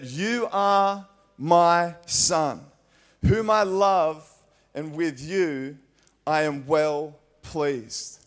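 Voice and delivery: slow, loud and solemn; voice of God. Acted. Preacher voicing God over Jesus at baptism, solemn and slow. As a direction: proclaim it like a voice from heaven. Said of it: "this voice from heaven".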